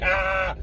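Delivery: silly high-pitched "ah"; stupid mock yell. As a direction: send up an out-of-control screamer. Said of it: "stupid, be like".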